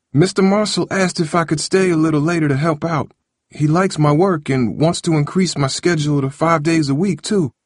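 put-on high, lively young man explaining; Harold making excuses. Harold making his excuse to Grandma.

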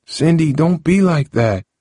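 high, pleading call; calling after her. A narrator's reading. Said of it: "Harold called to her".

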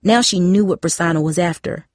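ordinary delivery

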